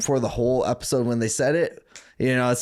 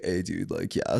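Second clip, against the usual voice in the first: lower, softer SoCal dude voice; Laguna buddy impression; 5 semitones lower, 5 dB quieter than usual. Laguna buddy fumbling through biology, laid-back dude impression. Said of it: "my buddy would be like".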